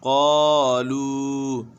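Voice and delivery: क़ालू very slow, long aa and long oo; both vowels stretched. Shown as a demonstration. Teacher repeating for learners, क़ालू held long. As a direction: draw out each half of क़ालू. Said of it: "दोनों खींचे".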